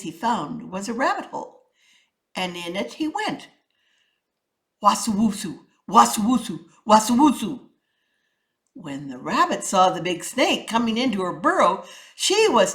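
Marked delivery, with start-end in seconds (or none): 4.8-7.7 sliding sound. A narrator's reading. Snake sliding into hole.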